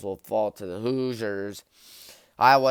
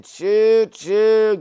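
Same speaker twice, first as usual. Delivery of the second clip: a playful train-whistle choo choo, higher and louder; 8 semitones higher, 7 dB louder than usual. Pretend. Cheering on the upset train with a mock train whistle. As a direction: toot it like a little train.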